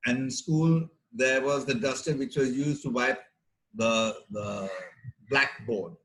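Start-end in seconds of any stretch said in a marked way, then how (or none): none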